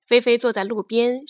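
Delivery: usual speaking voice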